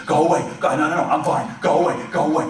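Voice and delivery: loud, high, pained shouting; yelling go away. Retold or imitated. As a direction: push dad away with a yell. Son insists, yelling at father.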